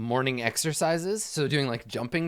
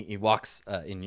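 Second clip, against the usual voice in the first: normal talking voice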